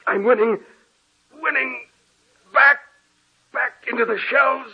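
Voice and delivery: high, desperate cries, shoving someone back; panicked shouts pushing a ghost into the shelves; frantic struggle. Acted. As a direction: fight her off in terror. Fighting off a ghost at the door.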